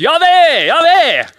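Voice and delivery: high, loud shout of a name; shouted cry. Acted. God calling out the name to Moses, high loud shout. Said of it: "som bare roper ut".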